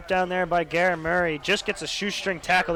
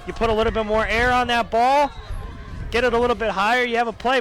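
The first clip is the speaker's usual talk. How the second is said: loud, raised voice making the point; emphatic analysis; 4 semitones higher, 4 dB louder than usual. Real, not acted. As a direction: hammer home what went wrong. Analyst stressing the fix.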